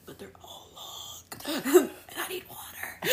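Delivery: whispered, then breaking into high laughter; laughing whisper. Retold or imitated. Mom laughing at her own complaint about long days.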